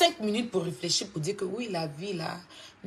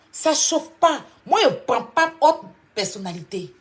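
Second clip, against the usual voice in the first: loud mocking boast; 6 semitones higher, 7 dB louder than usual. Shown as a demonstration. Rival insulted on a live stream, answered with a loud taunt. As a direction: shout it higher and louder.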